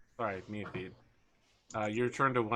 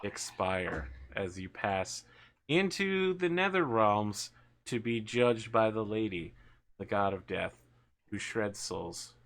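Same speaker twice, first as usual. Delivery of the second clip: game master narration; 3 semitones lower, slower than usual. Acted. Game master tells a player of their dying breath, slow narration. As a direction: draw out a solemn death scene.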